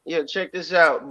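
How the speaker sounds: ordinary talk, nothing marked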